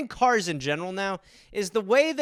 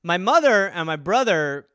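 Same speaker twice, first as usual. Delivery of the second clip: ordinary talk, nothing marked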